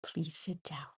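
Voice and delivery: stern whispered classroom command. Shown as a demonstration. Teacher whispering an order to her students, stern whisper. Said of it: "I'd be like".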